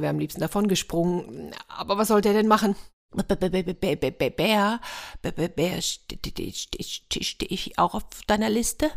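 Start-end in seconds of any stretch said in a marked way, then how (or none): 3.1-8.9 soft, frightened stutter of a little hare; a shaky hare stuttering in fear; trembling stammer. A narrator's reading. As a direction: stammer every word, scared stiff. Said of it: "die zitterte schon sehr".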